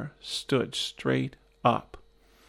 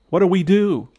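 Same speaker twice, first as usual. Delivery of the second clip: higher, louder call over noise; shouted question; 6 semitones higher, 6 dB louder than usual. Retold or imitated. Shouting to his friend over noisy whirlpool water, a raised shouted question. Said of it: "I shouted".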